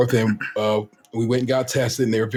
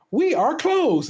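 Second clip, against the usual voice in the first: chirpy clerk; 11 semitones higher, much slower than usual. Retold or imitated. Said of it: "and they say".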